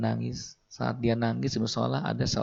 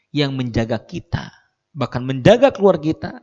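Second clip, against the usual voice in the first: rising preaching; 3 semitones higher, 5 dB louder than usual. Acted. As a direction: drive the point home, louder to the end. Sermon climax builds. (khotbah memuncak makin lantang)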